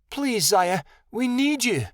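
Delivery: high, rising plea; desperate high-voiced appeal; pleading. A narrator's reading. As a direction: beg urgently, pitch climbing. Begging the dragon for help.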